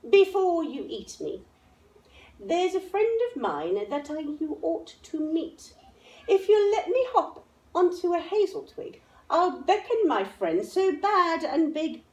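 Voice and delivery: high, quick little mouse voice; a tiny mouse talking his way out; clever mouse. A narrator's reading. Mouse bargaining with the Gruffalo's child, high and clever. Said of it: "said the mouse".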